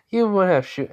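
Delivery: high, lightly mocking reply; amused disbelief. Girl scoffing at his threat to shoot, amused disbelief.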